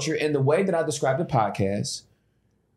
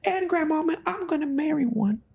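imitated teenage daughter; 12 semitones higher than usual. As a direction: play the smitten sixteen-year-old girl. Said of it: "That sixteen-year-old daughter's like".

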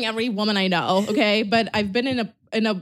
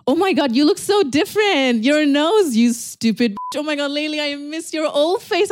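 higher, louder, teasing shock; 6 semitones higher, 4 dB louder than usual. Real, not acted. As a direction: gasp at how different she looks. Teasing shock at friend's face.

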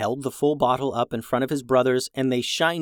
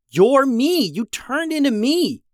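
very high, loud outburst; shocked yell; 11 semitones higher, 4 dB louder than usual. A narrator's reading. Brother sees Reed turn into him, shocked yelling. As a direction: shout it in high-pitched disbelief. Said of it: "Jackson yelled".

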